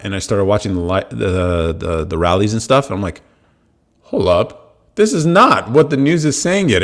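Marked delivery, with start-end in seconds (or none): 4.0-6.8 higher, surprised realization; dawning surprise. Retold or imitated. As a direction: let it dawn on you mid-sentence. Realizing rallies differ from news.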